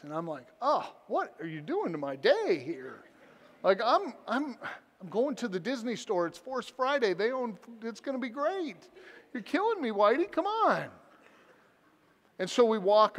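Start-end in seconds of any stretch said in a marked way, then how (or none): 0.6-10.9 high, squeaky comic whine; whiny protest. Retold or imitated. Dad whose Disney outing is threatened, whining in mock protest. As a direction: plead like a thwarted kid, voice leaping up.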